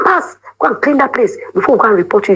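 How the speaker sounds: high-pitched pretend scolding, pushing her to go clean; a friend faking a stern command to cover the escape; mock bossy order.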